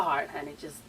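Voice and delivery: a catty hushed aside to the viewers; whispered aside. Pretend. Catty aside about Tommy.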